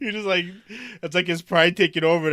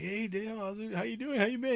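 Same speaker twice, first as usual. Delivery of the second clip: high, soft string of hellos; acted friendly greeting; 7 semitones higher, 8 dB quieter than usual. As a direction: imitate catching up, pitched up and quiet. Retelling a friendly greeting to old coworker.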